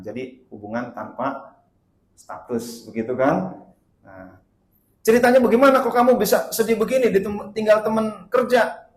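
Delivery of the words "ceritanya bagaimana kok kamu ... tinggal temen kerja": loud, high, hurried question; baffled questioning. Acted. Ustadz asking why a coworker's death hurts, baffled. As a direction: demand the story, voice raised.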